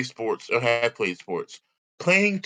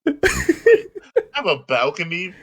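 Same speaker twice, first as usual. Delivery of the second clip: laughing; 6 semitones higher, 6 dB louder than usual. Real, not acted.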